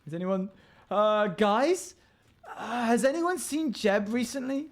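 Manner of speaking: high, loud worried call. Acted. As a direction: call out to everyone. Calling out for missing Jeb.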